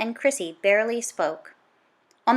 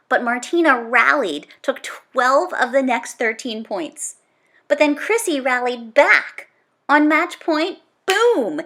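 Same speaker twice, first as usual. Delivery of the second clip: louder, building sports-commentary energy; excited play-by-play; 3 semitones higher, 6 dB louder than usual. A narrator's reading. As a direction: call the final points like a live commentator. Narrates match climax like play-by-play.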